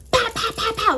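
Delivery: high, punchy pows; sharp high knocking noise; imitated loud banging. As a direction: mimic a fist banging on a door. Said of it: "like, super loud".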